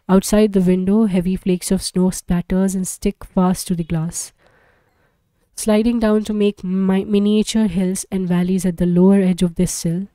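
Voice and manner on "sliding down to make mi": usual speaking voice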